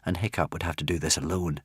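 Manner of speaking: plain reading voice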